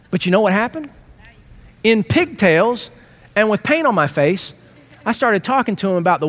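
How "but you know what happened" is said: ordinary talk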